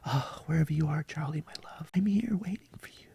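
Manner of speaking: high, hushed, fake-tearful whisper; mock Erica Kirk whisper. Mocking the widow looking up to her late husband, high whisper. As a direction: whisper upward like a staged grieving widow. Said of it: "That's my imitation of fucking Erica Kirk".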